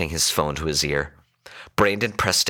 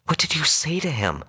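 alarmed questioning; 10 semitones higher than usual. A narrator's reading. Woman accuses her partner.

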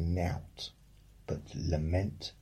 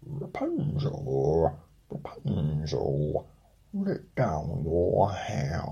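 slow, louder call up the tower, ending in a whisper; king's son calling; 4 dB louder, slower than usual. Acted. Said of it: "when the king's son came and cried".

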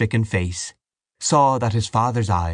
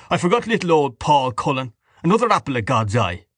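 higher-pitched mocking exclamation; coarse scorn; 5 semitones higher than usual. A narrator's reading. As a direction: jeer at the name. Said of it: "uttered a guffaw of coarse scorn".

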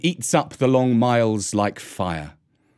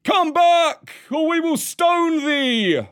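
high, slow, stretched-out yell; threatening crowd shout; slower than usual. A narrator's reading. Crowd threatens to stone Mesua, shouting. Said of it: "shouted the crowd".

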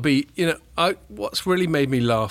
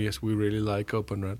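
a hint of a Swedish exec; Swedish accent; 2 semitones lower, 6 dB quieter than usual. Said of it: "That's my Swedish accent".

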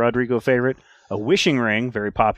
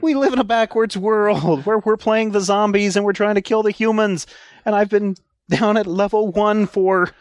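high, excited rant; 8 semitones higher than usual. Real, not acted. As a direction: let the joy bubble up. Excited at finally leveling up.

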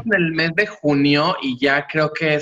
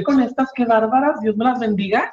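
amazed exclamation; 8 semitones higher than usual. Retold or imitated. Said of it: "y yo dije".

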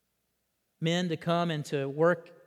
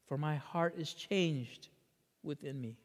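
tender; 7 dB quieter than usual. Acted. Preacher voicing God's changed heart, softly tender. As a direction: speak God's words gently, almost to one person.

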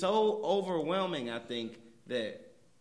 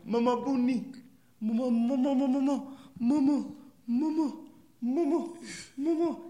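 mock wailing Mama; 7 semitones higher, 4 dB louder than usual. Mimicking an emotional movie scene.

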